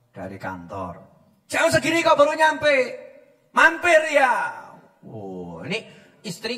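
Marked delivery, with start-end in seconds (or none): 0.1-1.0 meek reply. Retold or imitated. Husband answers wife meekly. 1.5-4.3 nagging wife. Retold or imitated. Wife interrogates husband.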